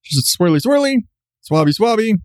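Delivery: high, sing-song swabbing; playful nurse. Retold or imitated. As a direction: chant it like a cheerful nurse swirling the swab. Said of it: "She's like".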